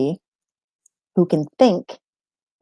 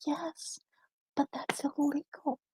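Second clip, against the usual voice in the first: whispered dry reply imitating herself as mom; deadpan whisper; 8 semitones higher, 15 dB quieter than usual. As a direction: hush it like a wry aside.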